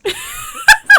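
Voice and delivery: loud high burst of laughter. Real, not acted. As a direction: cracks up loudly. Laughing at the Jeff line.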